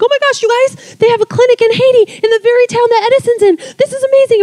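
bubbling over with joy; excited gushing. Retold or imitated. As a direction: gush like you just heard amazing news. Team celebrating good news.

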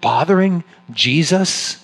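incredulous. Real, not acted. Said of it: "disbelief at the word".

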